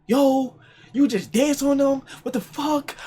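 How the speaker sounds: high, slow mocking girl; imitating the girl from the stage. Girl confronts him at party.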